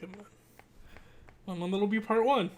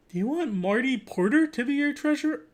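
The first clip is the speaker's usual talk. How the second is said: higher-pitched mock campaign announcer; put-on poster voice; 8 semitones higher, 6 dB louder than usual. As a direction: pitch it up and ask theatrically. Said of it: "why am I doing that voice".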